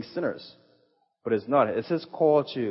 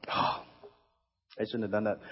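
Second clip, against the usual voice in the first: embarrassed sigh; 3 semitones lower, 7 dB quieter, much slower than usual. Real, not acted. Speaker reacts to his own mistake.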